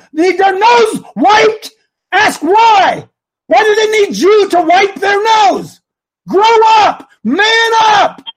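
loud, high impassioned shouting; mad-sounding passion. Real, not acted. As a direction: shout it as a furious challenge to pastors. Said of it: "See, now I sound mad".

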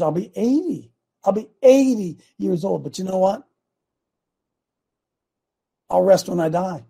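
usual speaking voice

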